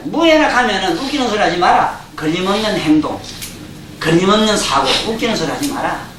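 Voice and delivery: ordinary delivery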